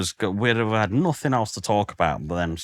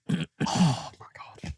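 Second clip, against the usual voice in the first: breathless whispered awe; 9 semitones higher, 5 dB quieter, slower than usual. Real, not acted. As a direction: hush it out in awe.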